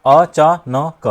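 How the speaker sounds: "अचानक" very slowly as अ, चा, ना, का; over-split word. Shown as a demonstration. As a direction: space out four open syllables in "अचानक". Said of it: "यहां बदल गया". ("अचानक" को अ... चा... न... क बोलें)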